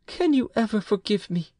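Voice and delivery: high pleading wife's voice. A narrator's reading. Wife begs her husband's forgiveness, high and pleading. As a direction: beg his forgiveness as the wife. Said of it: "Bertrande exclaimed".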